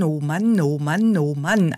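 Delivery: oh Mann with mouth wide then pursed; mouth exercise. Shown as a demonstration. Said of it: "Breitmaulfrosch und Spitzmaulfrosch".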